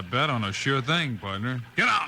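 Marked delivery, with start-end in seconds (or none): 1.8-2.1 shout. Acted. Shots fired from shore.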